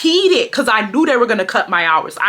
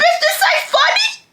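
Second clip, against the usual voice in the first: high mock shout. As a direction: screech it at them, laughing. Yelling at laughing thieves.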